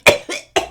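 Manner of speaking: loud mock retching cough; wife imitating husband gagging in the backyard, loud mock cough; imitated gagging cough. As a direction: fake a gag like he did.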